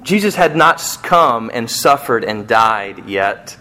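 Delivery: usual speaking voice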